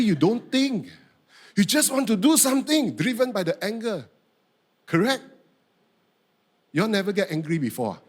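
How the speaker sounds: ordinary talk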